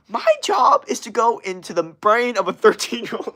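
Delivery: high, loud mimicked guru voice; Gary Vee impression. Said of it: "that video where he's like".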